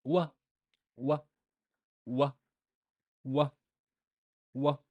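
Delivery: "Wa" repeated, short open a; waw with fatha. Shown as a demonstration. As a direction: drill "wa" evenly each time. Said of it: "how would I pronounce it".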